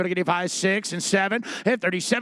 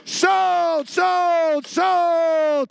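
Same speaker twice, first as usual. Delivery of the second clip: sold call; 9 semitones higher, much slower than usual. Real, not acted. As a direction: belt out sold like the gavel. Hammer falls, calls sold.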